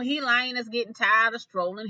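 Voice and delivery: usual speaking voice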